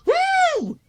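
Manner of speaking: high-pitched mock whoop; mock woo. Retelling the price shock, a squeaky mock whoop. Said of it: "I was like".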